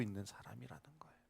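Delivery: soft whispered finish; hushed whisper. Real, not acted. Pastor whispers the point to the congregation. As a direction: breathe it as a secret.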